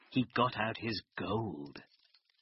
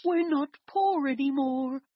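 high, loud joyful shout; excited cry; 13 semitones higher, 6 dB louder than usual. A narrator's reading. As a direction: cry out with glee. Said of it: "cried Jack's mother".

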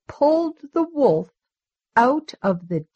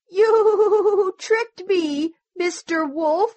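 crying sheep; 8 semitones higher than usual. A narrator's reading. Sheep crying at the wolf's trick, high quavering bleat. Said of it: "The sheep cried".

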